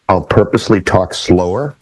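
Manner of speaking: ordinary talk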